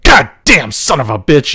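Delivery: ordinary delivery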